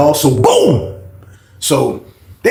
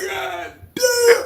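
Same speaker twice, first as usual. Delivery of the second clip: mock stunned; 14 semitones higher, 5 dB quieter than usual. Cellmate reacts to eighteen years.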